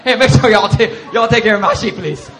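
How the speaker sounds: loud, laughing hammed-up call about the sheep; mock shouted instruction. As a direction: yell it like a joking shepherd. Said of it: "He's like".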